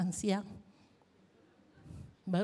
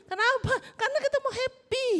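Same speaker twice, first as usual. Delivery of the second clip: excited; 13 semitones higher, 4 dB louder, faster than usual. Real, not acted. Cheerful exclamation.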